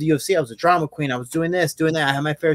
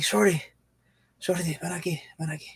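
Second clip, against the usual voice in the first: faint, fading beckon; hammed-up feeble plea, barely there; weak dying call; 8 dB quieter than usual. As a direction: call him over like you're at death's door. Exhausted friend calling him over.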